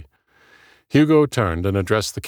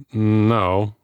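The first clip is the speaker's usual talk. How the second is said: usual speaking voice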